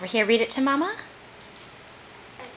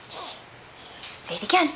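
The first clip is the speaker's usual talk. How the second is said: whisper; 4 semitones higher, 4 dB quieter, much slower than usual. Real, not acted. Coaxing toddler to repeat.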